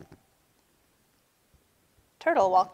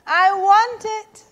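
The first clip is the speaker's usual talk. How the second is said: high, loud, slow whine; screeched demand; 10 semitones higher, 6 dB louder than usual. A narrator's reading. Lion copies Turtle's demand, screeching. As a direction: screech it like a tantrum. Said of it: "screeched Lion".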